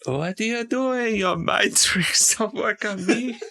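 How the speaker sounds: high-pitched impression of an alien trader, dragged out; Watto. Podcaster quoting the Star Wars junk dealer to tease a friend, high impression. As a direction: put on a high character voice, stretching the last words. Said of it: "Oh, Watto".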